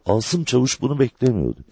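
ordinary delivery

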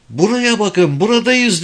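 high-pitched narrated shout; calling out. A narrator's reading. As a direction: call urgently for attention. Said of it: "diye bağırdım".

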